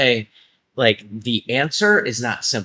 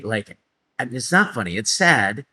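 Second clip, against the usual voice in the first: usual speaking voice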